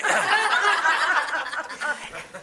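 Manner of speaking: bursts out in a high laugh; real amused laugh. Real, not acted. Laughs at own story.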